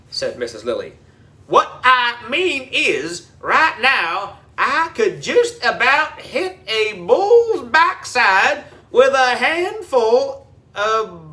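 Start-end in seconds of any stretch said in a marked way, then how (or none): 1.5-11.2 folksy country drawl. A narrator's reading. Groundskeeper folksy voice.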